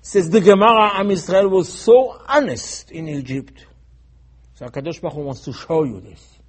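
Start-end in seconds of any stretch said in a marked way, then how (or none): 0.0-3.7 loud, high, hammering sermon delivery; impassioned raised-voice preaching; full-force preaching. Real, not acted. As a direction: thunder it at the congregation, pounding each point.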